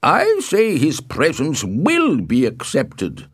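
slow, high, emphatic insistence; irate patriarch overruling him; angry. A narrator's reading. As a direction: lay down the law angrily, word by word. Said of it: "Capulet said angrily".

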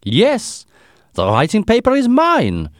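put-on high, loud German-accented protest; strong German accent. A narrator's reading. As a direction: insist loudly in a thick German accent. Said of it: "He had a strong German accent".

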